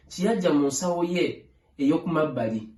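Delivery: usual speaking voice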